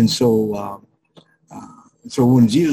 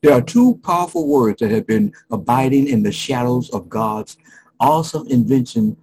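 normal talking voice

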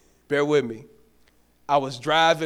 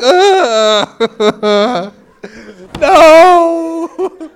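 embarrassed laugh; 9 semitones higher, 15 dB louder than usual. Retold or imitated. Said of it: "I sunk so low in my seat".

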